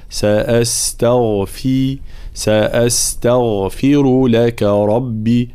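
slow سأستغفر, clear kasra on the ف. Shown as a demonstration. Teacher models سأستغفر for students, slow and precise. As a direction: stretch سأستغفر and show the ف vowel. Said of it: "نبين حركة الكسر في الفاء".

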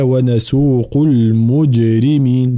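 slow recited المجرمين with qalqala on the jim; drawn-out المجرمين, jim released with a bounce; bouncing jim. Shown as a demonstration. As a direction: give the jim in المجرمين a small echoing bounce. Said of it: "جيم مقلقلة".

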